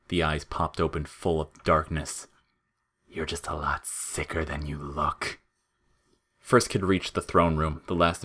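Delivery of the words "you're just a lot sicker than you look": low, soft sinister creature voice; dark taunt. A narrator's reading. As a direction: lean in and taunt. Flowey taunting darkly.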